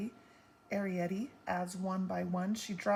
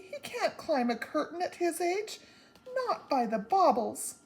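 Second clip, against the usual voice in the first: worried Homily; 9 semitones higher, 4 dB louder than usual. A narrator's reading. Homily worried about Pod.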